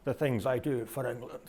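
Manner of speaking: a soft, lightly voiced Bond mutter; understated imitation of a muttered quip; muttered Bond line. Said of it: "double oh seven mutters".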